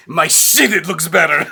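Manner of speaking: put-on "my shit, it looks better" in a proud Eastern European accent; Roman's accent. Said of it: "Roman says".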